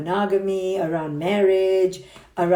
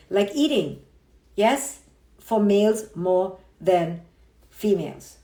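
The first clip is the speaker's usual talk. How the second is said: ordinary talk, nothing marked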